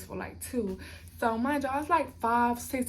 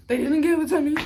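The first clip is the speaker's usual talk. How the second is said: indignant outburst; 4 semitones higher, 9 dB louder, faster than usual. Real, not acted. Indignant about the badge number.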